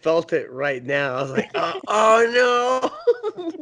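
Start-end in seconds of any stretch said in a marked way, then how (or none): 1.5-3.6 dismayed oh no. Retold or imitated. Reacting to feeling tooth.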